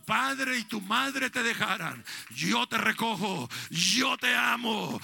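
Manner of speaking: loud, high proclamation of God's promise; preacher booming God's promise to his flock; full-force preaching. Acted. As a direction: build from firm to a shouted climax.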